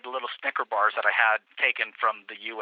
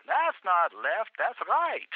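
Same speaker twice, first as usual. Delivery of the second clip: slow, much higher mock correction; the partner teasingly sets him straight; laughing partner; 9 semitones higher, much slower than usual. Partner correcting the policeman, slow and high.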